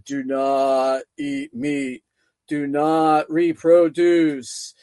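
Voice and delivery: very slow, deep mock God voice. Demons faking God the Father's voice, deep and slow. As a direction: intone it low, word by word, as a solemn command. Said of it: "said in a much deeper tone".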